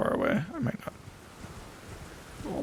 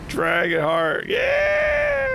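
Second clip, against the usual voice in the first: slow loud cheer, then high yell; loud stretched cheer ending in a high yeah; victory shout; 6 dB louder, much slower than usual. Real, not acted. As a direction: roar out the prize.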